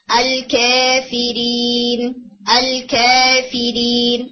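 الکافرین very slow, alif leaning slightly toward ya; taqlil. Shown as a demonstration. Teacher demonstrating taqlil. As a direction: tilt the alif of الکافرین just a little. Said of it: "اس طرح سے ہلکا سا اس کے اندر ٹیڑھا پن آتا ہے".

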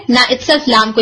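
ordinary talk, nothing marked

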